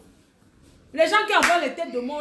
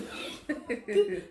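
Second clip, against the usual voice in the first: soft laugh; laughter; 3 semitones higher, 7 dB quieter than usual. Real, not acted. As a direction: laugh softly at the complaint. Laughs at listener's "je souffre. (petit rire doux)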